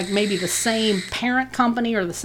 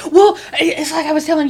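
laughing through words; 8 semitones higher, much faster than usual. Real, not acted. Laughing after funny story.

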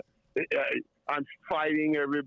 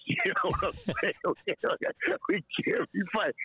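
laughing; 4 semitones higher than usual. Real, not acted. Laughing at his own story.

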